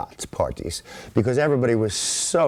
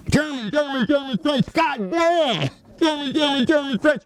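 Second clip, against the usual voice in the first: mock foreign oaths. Von Steuben cursing his men in three languages, high rant. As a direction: rattle off the oaths like a furious drillmaster. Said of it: "a string of oaths in Prussian and German and French".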